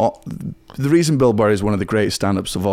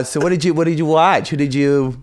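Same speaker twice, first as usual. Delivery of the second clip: put-on American woman's voice, higher; female American accent; 5 semitones higher than usual. Scot improvising as woman who watched a special, female American accent. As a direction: play an American girl chatting about Netflix. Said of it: "I can only do female American accent".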